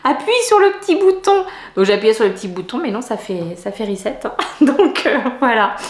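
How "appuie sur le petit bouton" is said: high-pitched voice for someone else's tip; quoted advice. Retold or imitated. Vlogger relays someone's button tip, high quoting voice. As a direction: lift the pitch sharply to quote an instruction. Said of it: "quelqu'un m'a dit".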